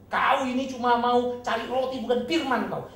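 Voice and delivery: slow, loud, high stern rebuke; harsh rebuke. Retold or imitated. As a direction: scold them hard, deliberately. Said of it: "Yesus bilang".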